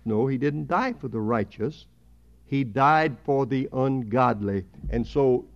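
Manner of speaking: usual speaking voice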